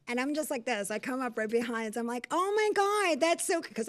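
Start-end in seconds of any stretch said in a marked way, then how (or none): 2.3-3.6 high, loud mock delight; fake-sweet squeal of admiration; smart-ass gushing. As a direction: gush sarcastically, pitched way up. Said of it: "I'm a smart ass".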